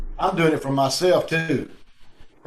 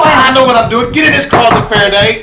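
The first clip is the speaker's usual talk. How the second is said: high, loud barked orders; loud commands; 7 semitones higher, 9 dB louder than usual. Acted. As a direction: force him in at gunpoint. Blackie orders Faraday into closet.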